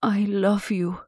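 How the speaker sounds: a trembling hint of emotion; lover's confession, understated; tender Laura. A narrator's reading. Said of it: "pout trembled with emotion".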